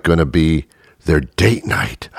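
ordinary delivery

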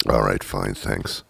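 muttered resignation. Pretend. Sulky mumbled resignation.

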